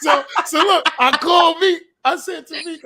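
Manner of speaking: high, loud words through laughter; laughing. Real, not acted. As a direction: keep talking while cracking up. Laughing while telling the story.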